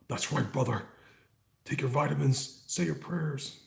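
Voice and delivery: hammed-up Hulkster catchphrases; Hulk Hogan impression. Streamer imitating Hulk Hogan for chat, hammed-up. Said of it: "I don't sound like Hulk Hogan".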